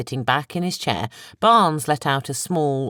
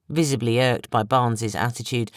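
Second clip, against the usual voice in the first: ordinary delivery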